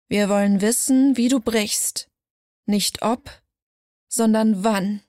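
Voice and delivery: understated cold menace; a touch of coldness in the voice. A narrator's reading. Mirror figure tells Liora she will break, mildly cold. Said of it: "Tiefer. Kälter".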